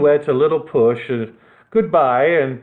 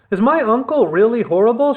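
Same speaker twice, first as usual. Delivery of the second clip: lightly voiced little girl, higher; little princess; 8 semitones higher than usual. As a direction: ask like a small worried girl. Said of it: "she asked".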